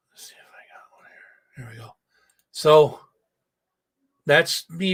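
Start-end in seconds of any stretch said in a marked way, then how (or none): 0.1-1.9 whispered aside to himself; host whispering while looking for a photo. Real, not acted. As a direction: murmur it under your breath while searching. Host searching, aside off-mic.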